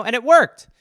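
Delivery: ordinary delivery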